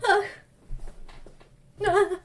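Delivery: mock-tearful, halting apology with long pauses; milk every pause like a tortured melodrama hero; hammed-up dramatic. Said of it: "he's all dramatic".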